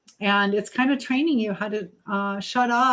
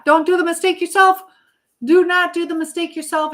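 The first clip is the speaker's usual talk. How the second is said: raised, louder insistent plea; emphatic warning; 8 semitones higher, 6 dB louder than usual. Real, not acted. Streamer urging a viewer not to repeat others' mistakes, emphatic warning. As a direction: urge it firmly, pitched high and loud.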